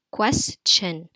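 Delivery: "question" in two beats, quest, then shin; split into syllables. Shown as a demonstration. Teacher showing pupils a new word, split into syllables. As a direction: pause clearly inside "question". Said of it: "Quest, this is the first syllable".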